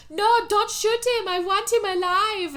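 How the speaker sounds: loud, high-pitched pleading; mock frantic plea. Zachary begs them not to shoot, mock frantic. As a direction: cry out in mock desperation. Acted plea to spare shark.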